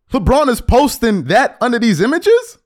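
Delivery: ordinary delivery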